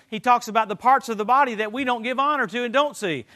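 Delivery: usual speaking voice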